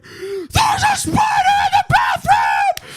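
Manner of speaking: high loud terrified shriek; panicked yell. Retold or imitated. Boy telling his mom about the spider, shrieking. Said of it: "And I was like".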